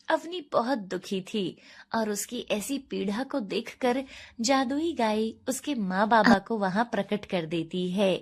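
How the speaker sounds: usual speaking voice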